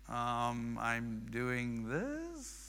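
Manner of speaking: put-on sheepish, drawn-out mumble of a child; imitating a child asked twice; muted reply. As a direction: answer very slowly and softly, trailing into a question. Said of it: "the response was a little more muted".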